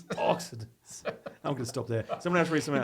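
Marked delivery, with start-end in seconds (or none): 0.0-1.0 sexy voice. Pretend. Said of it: "That did not work".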